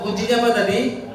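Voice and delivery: ordinary talk, nothing marked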